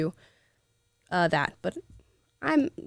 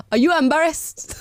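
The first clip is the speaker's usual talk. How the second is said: put-on lightly Nigerian-accented, rising higher; a touch of Nigerian accent; 4 semitones higher, 3 dB louder than usual. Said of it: "Gotta bring out my Nigerian accent for that".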